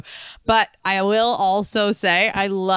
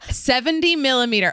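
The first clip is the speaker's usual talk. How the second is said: ordinary talk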